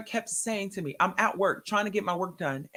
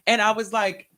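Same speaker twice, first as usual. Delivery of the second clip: usual speaking voice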